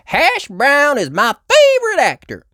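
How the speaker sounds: put-on high, loud fan-girl rabbit; Miss Rabbit voice. A narrator's reading. Miss Rabbit gushing about her favourite actor, high and eager. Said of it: "said Miss Rabbit".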